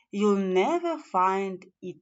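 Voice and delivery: ordinary delivery, nothing marked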